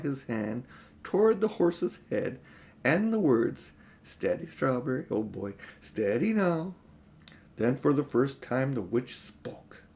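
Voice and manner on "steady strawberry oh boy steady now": gentle the horse down; cabby hushing his frightened horse; soothing the horse. Acted.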